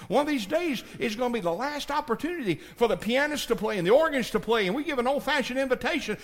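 rising high, loud preaching climax; preaching at full force. Acted. Preacher calling listeners to be saved, building to shout. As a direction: build to a shout, stretching the last words.

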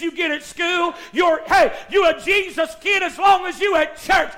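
loud, driving revival sermon; full-force preaching. Real, not acted. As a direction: build urgently through repeated phrases, stretching out the last words.

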